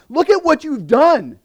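hammed-up anger at Moses; ticked off. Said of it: "They were ticked off".

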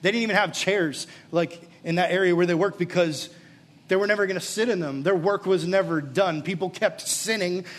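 rising preacher's emphasis, building force; earnest sermon delivery, pressing each point; preaching. Acted. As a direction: preach it with full conviction from the pulpit. Preaching the sacrifice message.

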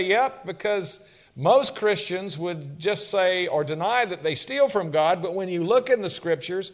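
loud, high, fervent pulpit voice; a preacher building to full force; full-force preaching. Acted. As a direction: preach it out to the whole room.

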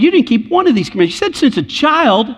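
Jesus confronting the rich man's boast; mock rebuke. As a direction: call him out on his claim.

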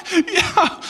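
dad's chuckle into a high word; imitated laughing. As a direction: imitate a dad laughing at you. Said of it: "Yeah, he's laughing".

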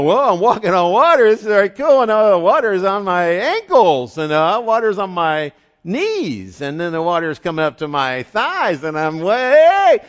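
high, loud, hammed-up rising panic; mock freak-out. Peter feels water climbing his legs, hammed-up freak-out. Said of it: "And he freaks out".

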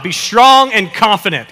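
loud, lifted, firm declaration; strong and confident. Shown as a demonstration. Pastor echoing a child's line to the people, strong and confident. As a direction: project it boldly to your people. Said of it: "say it strong and confidently".

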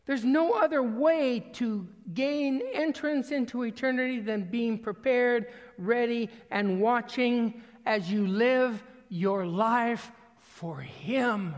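high, emphatic preacher's delivery, settling on the last words; forceful preaching. Real, not acted. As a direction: drive the closing appeal home to the congregation. Preaches the closing exhortation.

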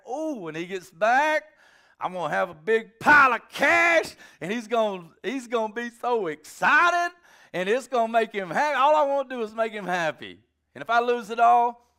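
eager servant gushing loudly; excited servant. Retold or imitated. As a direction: gush about pleasing the master. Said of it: "these guys that were like".

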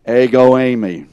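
"Ego eimi" rung out loud, stress on "Ego"; preacher booming "Ego eimi" at the climax; loud proclamation. Acted. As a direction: declare "Ego eimi" like a verdict. Preacher proclaims Jesus' answer.

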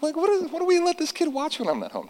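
shocked high squeak then fast bewildered rant; mock alarm, jumping way up in pitch; startled what. Dad reacting to daughter's magic mouse story, high incredulous shriek. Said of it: "and I'm going".

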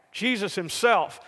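ordinary delivery

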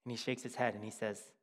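ordinary talk, nothing marked

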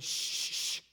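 drawn-out hissed shushes; disapproving hush. Acted. Churchgoer silencing a fellow worshipper, hushing. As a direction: shush a noisy neighbour. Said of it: "are you that person going".

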